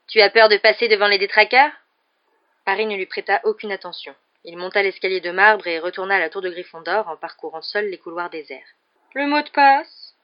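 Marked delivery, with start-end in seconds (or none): 0.1-1.7 loud taunting question; jeering call. A narrator's reading. Rival pupil taunts from the queue about being afraid, jeering. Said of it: "cria Malfoy". 9.1-10.1 high, slow, louder question; drawn-out sleepy demand in a high voice; drowsy portrait lady. A narrator's reading. Dozing portrait lady asks the pupil for the password, drowsy. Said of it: "la grosse dame qui somnolait".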